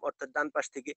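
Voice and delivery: ordinary talk, nothing marked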